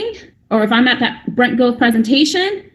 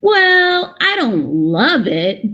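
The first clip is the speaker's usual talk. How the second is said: hesitant hedging; 2 semitones higher, much slower than usual. Acted. Recruit answering whether they love it, hesitant and drawn out. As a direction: imitate someone squirming round the honest answer. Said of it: "Some people even stutter over words".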